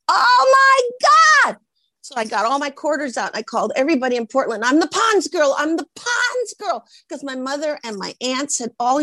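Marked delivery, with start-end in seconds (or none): 0.1-1.6 very high, loud, drawn-out shout; reenacted overjoyed scream; ecstatic shriek. Reenacts hearing she landed commercial. 4.6-5.5 high, giddy announcement; acted-out elation; excited boast. Phoning everyone with the news. 5.6-6.9 very high, stretched-out squeal; slow, acted-out elation; squealed boast. Repeats the news, escalating.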